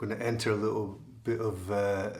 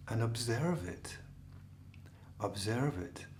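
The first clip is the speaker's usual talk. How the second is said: guided meditation. Acted. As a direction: guide them gently into stillness.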